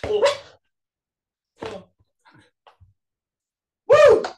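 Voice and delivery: high squeaky helium whoop; helium squeal. Pretend. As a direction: shriek it out on helium. After inhaling helium.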